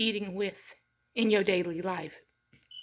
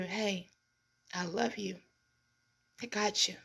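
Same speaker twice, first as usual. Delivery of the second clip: soft, much quieter reassurance; tender friend; 3 semitones lower, 10 dB quieter than usual. Pretend. Friend calling to reassure someone, soft and tender. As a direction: hush it like comforting a friend.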